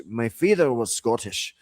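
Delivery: broad Scottish brogue; Scottish accent. Shown as a demonstration. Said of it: "broad Scottish accent".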